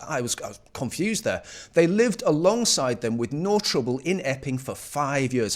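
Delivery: ordinary talk, nothing marked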